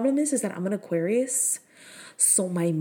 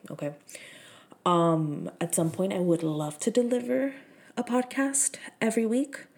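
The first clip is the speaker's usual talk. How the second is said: ordinary delivery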